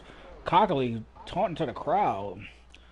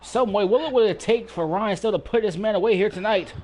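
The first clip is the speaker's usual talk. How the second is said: higher, louder amazed call; wrestling commentator; 6 semitones higher, 7 dB louder than usual. Acted. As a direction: marvel at the survival. Disbelief after kickout.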